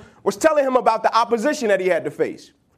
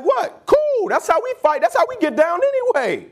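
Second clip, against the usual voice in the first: pitched way up in delighted surprise; eager acceptance; 7 semitones higher than usual. Retold or imitated. Achilles' king accepting the one-on-one fight, high and thrilled. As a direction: jump at the challenge, thrilled.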